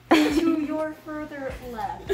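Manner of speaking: tour guide. Pretend. Corrected cosplayer redirecting the tour, playful tour guide. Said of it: "do it as a tour guide".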